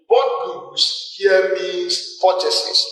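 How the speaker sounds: very slow, word by word; slow teaching emphasis; emphasized slowly. Shown as a demonstration. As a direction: spell it out slowly for the learners. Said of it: "I emphasize that in accounting".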